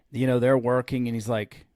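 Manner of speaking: ordinary talk, nothing marked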